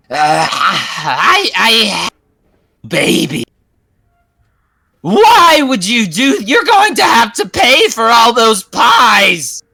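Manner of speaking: high, slow, loud witch outrage; indignant witch. Acted. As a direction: sputter then demand payment. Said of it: "says to you".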